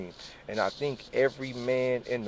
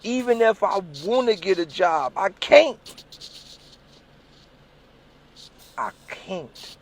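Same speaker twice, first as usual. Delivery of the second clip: desperate vow; 9 semitones higher, 4 dB louder than usual. Retold or imitated. Vowing to himself.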